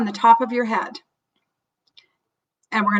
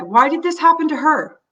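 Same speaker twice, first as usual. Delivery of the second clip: higher, louder pained why; acted distress, pitched up and louder; anguished question; 6 semitones higher, 4 dB louder than usual. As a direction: cry out at the unfairness. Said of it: "your own sense of panic".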